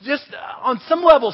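ordinary talk, nothing marked

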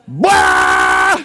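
loud, low blurt bursting out; blurted shout. Retold or imitated. Pastor reliving the word that escaped him, loud shout. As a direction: let it burst out like a train whistle. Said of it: "blasted out of my mouth like a train whistle".

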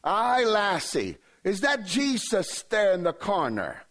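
high, drawn-out mock Scottish accent; weird accent. Bent-over man asking the lassie about Jesus, hammed-up Scottish accent. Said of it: "had a weird accent".

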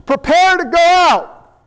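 acted boss's drawn-out order; calling the crew. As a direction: call out to the whole crew. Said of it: "he calls the whole crew".

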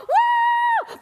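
high, loud whoop of joy; thrilled high cheer; excited whoop. Retold or imitated. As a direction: whoop with glee. Celebrating the ice cream.

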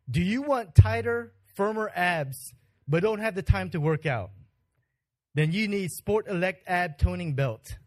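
loud, punchy TV commercial announcer. Shown as a demonstration. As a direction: sell it like an infomercial. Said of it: "announcer voice and commercial voice".

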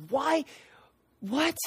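high-pitched, baffled questions; mock bewilderment with a pause between words; imitated astonishment. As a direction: act out disbelief, voice lifting high. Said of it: "probably blown out of their minds".

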